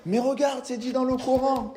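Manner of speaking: high, insistent voice of a listener objecting; imagined objector. Pretend. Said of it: "Et toi, tu vas me dire".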